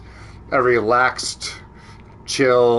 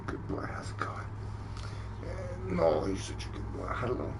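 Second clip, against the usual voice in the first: hushed, breathy pet talk; whispering; 7 semitones lower, 17 dB quieter than usual. Real, not acted. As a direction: whisper sweetly to the dog. Whispering to the dog.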